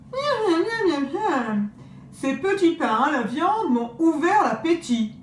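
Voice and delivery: high, smacking greedy wolf. Acted. Wolf quizzing grandmother about her granddaughters, greedy and sly. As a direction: lick your chops and pry sweetly.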